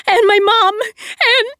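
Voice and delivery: high, loud, crying and breaking; sobbing. Acted. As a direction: choke on the words. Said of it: "a sob swelled in her throat".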